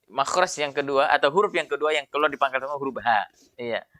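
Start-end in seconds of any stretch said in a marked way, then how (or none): none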